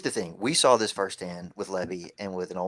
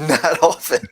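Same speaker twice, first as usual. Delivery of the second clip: laughing; 7 semitones higher, 9 dB louder, slower than usual. Real, not acted. Laughing rebuttal to friend.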